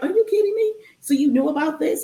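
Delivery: high-pitched disbelieving challenge; retold incredulity. To the boyfriend who knew about the plantation, incredulous. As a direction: throw the questions back, astonished.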